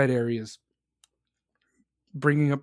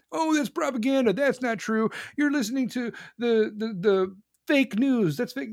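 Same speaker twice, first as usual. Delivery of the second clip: higher, sneering mock of deniers; mocking denial; 6 semitones higher than usual. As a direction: sneer their excuses back at them. Mocking Russia's defenders.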